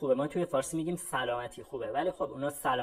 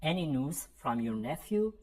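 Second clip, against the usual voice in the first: slow, clearly spaced English for learners; slow model sentence; much slower than usual. Shown as a demonstration. Teacher modelling a phrase for students, slow and clear. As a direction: say each word slowly so students can repeat.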